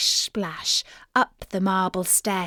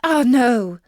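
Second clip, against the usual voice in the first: louder frightened "ah, no"; frightened princess; 3 semitones higher, 7 dB louder than usual. A narrator's reading. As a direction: blurt it out in fright. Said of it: "replied she".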